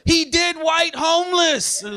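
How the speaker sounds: high, drawn-out thrilled report; excited fan. Acted. Fan telling friend about the show, high slow excitement. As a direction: stretch it out high, like quoting a hit.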